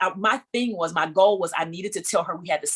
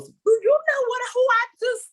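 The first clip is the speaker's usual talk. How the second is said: high, much higher exasperated outburst; retold high-pitched indignant protest; excited protest; 3 dB louder than usual. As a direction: burst out high in disbelief. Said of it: "I said".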